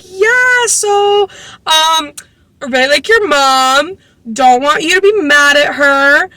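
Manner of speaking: high-pitched, slow, overly friendly small talk; hesitant, hammed-up high voice; awkward. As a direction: imitate someone awkwardly trying to win a kid over. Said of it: "like, the most awkward".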